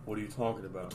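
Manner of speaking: soft low American accent imitation; imitating American accent. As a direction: do his quiet American accent. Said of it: "He just talks like".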